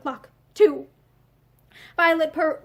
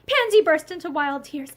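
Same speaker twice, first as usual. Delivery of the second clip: plain reading voice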